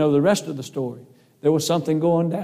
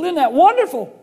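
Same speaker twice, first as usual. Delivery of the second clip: much higher, louder, gushing question; overjoyed; 12 semitones higher, 5 dB louder than usual. Retold or imitated. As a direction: beam with delight as you ask. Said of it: "I was so excited".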